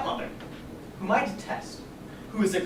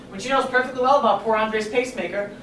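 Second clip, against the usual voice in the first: usual speaking voice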